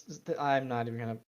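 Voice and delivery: usual speaking voice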